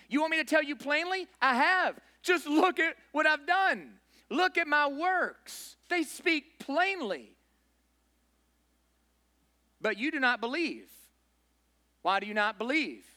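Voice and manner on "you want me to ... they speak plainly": higher, slow and insistent; emphatic Jesus. Acted. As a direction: lean on each word, pleading them to see. Preacher voicing Jesus emphatically.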